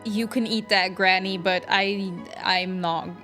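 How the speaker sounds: ordinary delivery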